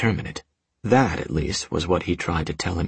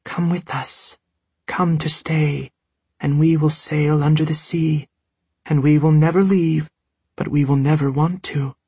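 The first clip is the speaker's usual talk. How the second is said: high, airy, otherworldly call; a ghost singing from the wind; ghostly voice; 9 semitones higher, 3 dB louder than usual. A narrator's reading. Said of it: "her voice on the wind".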